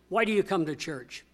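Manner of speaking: normal talking voice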